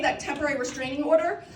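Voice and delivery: normal talking voice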